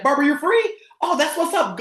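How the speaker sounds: high-pitched, thrilled phone reaction; getting excited. Shown as a demonstration. Coach hears Barbara is free, acting excited. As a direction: burst out delighted at the good answer. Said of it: "I'm gonna get excited".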